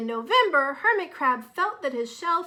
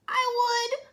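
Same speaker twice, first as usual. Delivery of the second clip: high, loud and eager; bright lanternfish voice; 12 semitones higher, 7 dB louder than usual. Acted. Lanternfish offering to light the house, high eager voice. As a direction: pipe up brightly. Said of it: "replied one lanternfish".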